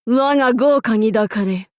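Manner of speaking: ordinary delivery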